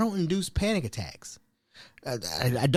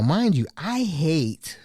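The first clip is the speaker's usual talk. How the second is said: ordinary delivery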